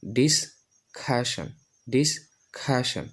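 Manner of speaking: ডিসকাশন slowly split, "dis-KHA-shan", with aspirated k; new pronunciation. Shown as a demonstration. Teacher models for learners, ডিসকাশন as slow "dis-khashan". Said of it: "এই চারটা উচ্চারণ করি".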